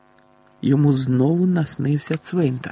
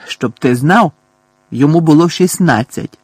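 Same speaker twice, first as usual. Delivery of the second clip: loud, forceful retort; indignant shout; 3 semitones higher, 7 dB louder than usual. A narrator's reading. Boy shouting back at his taunting cousin, indignant shout. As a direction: throw it back loudly at the taunt. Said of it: "крикнув Дадлі".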